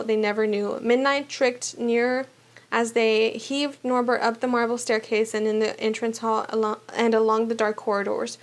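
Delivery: ordinary delivery, nothing marked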